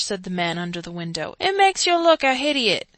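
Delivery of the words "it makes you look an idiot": higher, louder cockney scold; higher cockney jeer; rough cockney aside. A narrator's reading. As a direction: sneer at the speaker. Man under window scolding.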